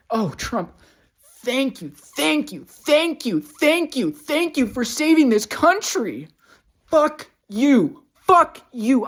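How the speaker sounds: high, loud mocking thank you turning to yelled curses; furious sarcastic rant. Real, not acted. Ranting at Trump over lost Medicaid, high furious yell. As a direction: scream sarcastic thanks then curse him out.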